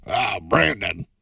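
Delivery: slow, drawn-out gruff-father impression; Nick Nolte. Shown as a demonstration. Host imitating an actor's role in Warrior, slow impression. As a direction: stretch it out as the actor's movie father. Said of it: "I can do a Nick Nolte impression".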